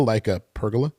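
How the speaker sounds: "pergola" said PER-guh-la; standard pergola. Shown as a demonstration. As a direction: stress the first syllable of "pergola", middle reduced. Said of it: "Is a pergola like a pergola?".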